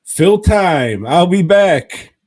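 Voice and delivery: loud. Real, not acted. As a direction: yell it while hurrying off. Rushing off to the toilet.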